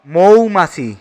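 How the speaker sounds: মৌমাছি said as "MOU-ma-chhi"; model pronunciation. Shown as a demonstration. Teacher answers for মৌমাছি, modelling it with a clear "mou". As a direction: clear "ou" glide opening মৌমাছি. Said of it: "মৌমাছি এর সঠিক উচ্চারণ হলো".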